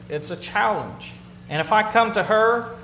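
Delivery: ordinary talk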